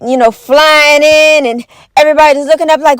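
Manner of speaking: ordinary talk, nothing marked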